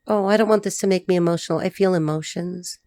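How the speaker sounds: ordinary delivery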